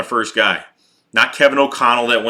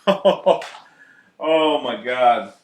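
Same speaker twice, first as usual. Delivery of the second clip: laughing disbelief; much slower than usual. Real, not acted. Laughing at idea of Press Taylor.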